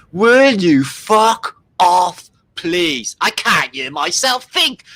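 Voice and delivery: slow, loud exasperated yell; mock angry. Annoyed man yelling at a noisy neighbour. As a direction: snap at the noise.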